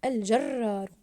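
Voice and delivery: "الجرار" loud, first ر rolled and held; stressed doubled letter. Shown as a demonstration. Teacher confirming the doubled ra, stressed doubled letter. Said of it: "ضاعفنا حرف الراء في كلمة".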